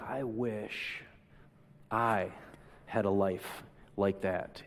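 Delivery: soft and slow, almost whispered; hushed wish. Pretend. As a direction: breathe the wish out slowly.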